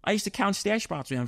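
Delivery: normal talking voice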